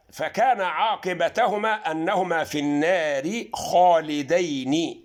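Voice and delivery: Quran recitation. Shown as a demonstration. Teacher recites Fussilat verse to viewers, slow chant. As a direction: chant the verse out, drawn long.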